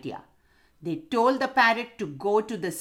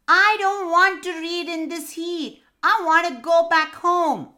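high, loud whining little parrot; whiny baby parrot; 10 semitones higher, 6 dB louder than usual. A narrator's reading. Baby parrot to the owl teacher, high whining. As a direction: whine it like a cranky little bird. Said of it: "she started whining".

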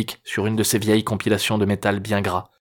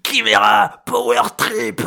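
put-on high cackling old man spitting words; snarling with hyena giggles; 11 semitones higher, 4 dB louder, slower than usual. A narrator's reading. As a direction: spit it out between wild cackles. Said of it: "Entre deux éclats d'un puissant rire de hyène".

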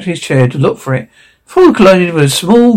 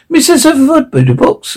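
polite waiter; 11 semitones higher than usual. A narrator's reading. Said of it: "inquired the waiter".

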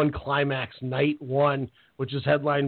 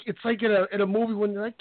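ordinary talk, nothing marked

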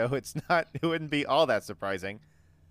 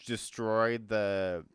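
normal talking voice

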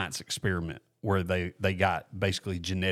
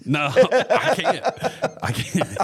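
laughing refusal; 10 semitones higher, 6 dB louder, much slower than usual. Real, not acted.

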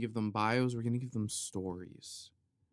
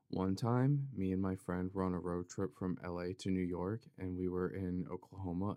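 ordinary talk, nothing marked

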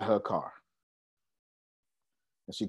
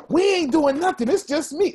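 much higher, louder imitation of a frightened wife; scared wife quote; 3 dB louder than usual. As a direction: squeak it out like a panicked wife.

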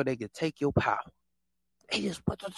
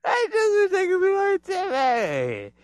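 mock crying. Mocking envious imitator.

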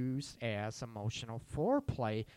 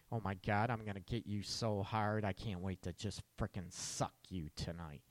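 sultry dirty talk; 5 semitones lower, 6 dB quieter than usual. Retold or imitated. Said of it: "reading a text message".

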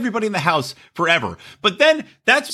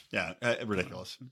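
ordinary talk, nothing marked

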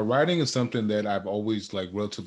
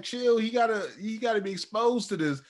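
much higher, louder casual dismissal; mock brushing her off; 10 semitones higher, 3 dB louder than usual. Dad waves off his wife about gym fumes, high mock-casual. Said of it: "I'm like".

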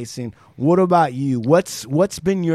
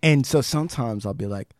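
ordinary talk

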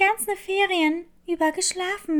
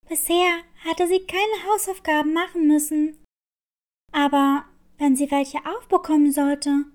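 ordinary delivery

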